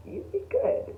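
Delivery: usual speaking voice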